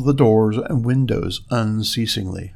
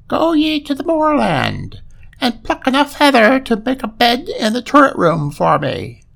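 high, loud eerie ghost command; haunting spectral order from the grey ghost; phantom voice; 12 semitones higher, 3 dB louder than usual. Acted. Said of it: "said the phantom-like figure".

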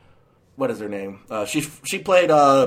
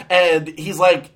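ordinary talk

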